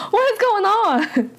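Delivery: high-pitched incredulous outburst; baffled wail, falling at the end; exasperated bafflement. Real, not acted. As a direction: pitch it way up in disbelief. Repeats her bafflement at the plot.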